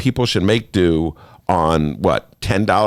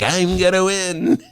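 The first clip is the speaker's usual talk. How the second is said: smug Trump; 8 semitones higher, slower than usual. Retold or imitated. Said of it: "sailing along like".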